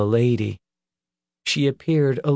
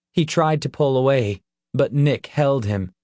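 plain reading voice

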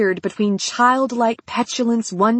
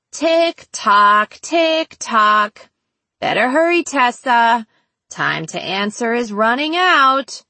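playful, swooping clock-ticking taunt; taunting countdown; 3 dB louder, slower than usual. Acted. Captor hurrying his captive for an answer, tauntingly playful. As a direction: tease the victim as time runs out.

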